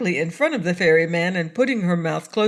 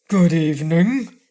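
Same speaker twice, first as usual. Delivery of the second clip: louder frogman greeting; 6 dB louder than usual. A narrator's reading. Frogman greeting a ferryman who will not look up, loud frogman voice. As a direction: greet in a loud, unhurried character voice.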